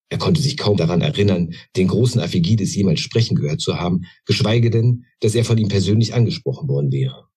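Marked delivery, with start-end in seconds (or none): none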